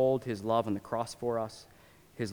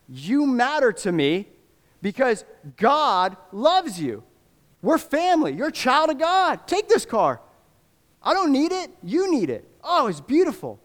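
impassioned; 11 semitones higher, 5 dB louder than usual. Retold or imitated. Preacher voicing the generous man.

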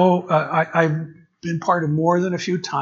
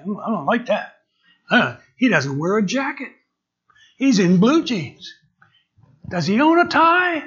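higher-pitched mock grumbling; disapproving congregant; 6 semitones higher, 4 dB louder than usual. Congregant judging the new pastor's clothes, mock disapproval. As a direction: act out a picky, scandalized churchgoer. Voicing a fault-finding congregant.

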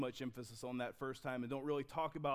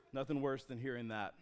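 normal talking voice

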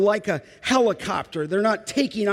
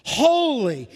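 higher, drawn-out cry of praise; 3 semitones higher, 3 dB louder, slower than usual. Acted. Seraphim calling around the throne, crying out in praise. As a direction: cry it out, pitch lifted then falling. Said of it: "They are crying out in antiphonal praise".

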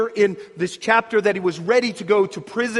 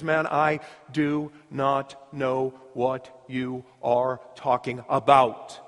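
slow, lower, word-by-word denial; deliberate denial; 5 semitones lower, 3 dB quieter, slower than usual. Acted. Peter denying it to his accuser, slow and deliberate. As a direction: space out every word firmly.

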